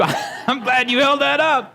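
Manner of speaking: high laughing delight; cracking up, slow and high. Real, not acted. Laughs at listener holding up Bible.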